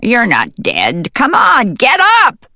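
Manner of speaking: rising into a high, loud shout; excited. Acted. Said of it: "she heard the voice again".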